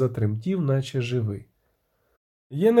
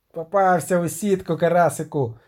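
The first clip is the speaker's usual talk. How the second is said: mildly raised, crowing shout; gloating yell. A narrator's reading. Kozojed to the trapped dragon, gloating yell. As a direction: crow over a netted catch. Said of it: "дерся козоїд".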